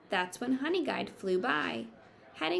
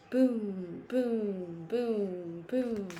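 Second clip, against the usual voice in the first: demo boom; much slower than usual. Shown as a demonstration. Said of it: "you might hear".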